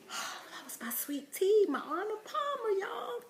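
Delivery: high mock wail that fades to a whisper; lightly hammed-up sorrow over a sweet tea; mock lament. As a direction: grieve the drink in jest. Mourning her lost drink.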